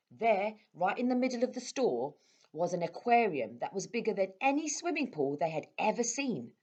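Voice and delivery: ordinary delivery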